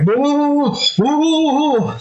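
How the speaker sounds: high, startled cries of a man losing control; high alarmed whoa as the tire careens; panicked whoa. Acted. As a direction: yelp it like you're rolling downhill out of control.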